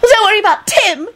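high-pitched sarcastic outburst; shrill, foot-stamping protest; indignant scream. A narrator's reading. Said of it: "Esther screamed and stomped her foot".

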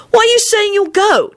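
put-on very high child's challenge; demanding. A narrator's reading. Timothy questioning Quentin's offer, demanding. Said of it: "Timothy demanded".

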